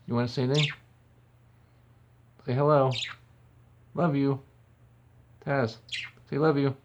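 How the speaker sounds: loud high coaxing of the bird; coaxing pet. Real, not acted. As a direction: urge the bird to talk. Prompting bird to talk.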